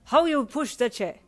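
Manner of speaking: blunt. A narrator's reading. Missus Happy challenges Pa over the chair, blunt. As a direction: throw the question at him hard. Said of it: "she asked bluntly".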